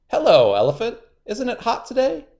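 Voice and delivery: sly spider, high and sweet; a crafty trickster sounding friendly; high, cunning spider; high Anansi voice. A narrator's reading.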